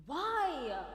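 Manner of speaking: loud, high-pitched whiny 'why'; exclaimed protest. Acted. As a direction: cry out in exaggerated protest. Exaggerated questioning in acted dialogue.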